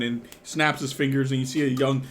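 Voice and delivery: normal talking voice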